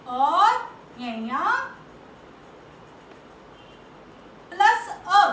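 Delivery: अ said loud and drawn out; stress on अ. Shown as a demonstration. Teacher building the conjunct for pupils, punching अ. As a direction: lean on "plus अ". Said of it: "teacher stressing the added a".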